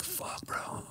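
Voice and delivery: voiceless under-the-breath "fuck, bro"; whispered curse. Retold or imitated. Driver at gunpoint on highway, whispered curse. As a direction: barely breathe it out in disbelief. Held spread eagle on highway.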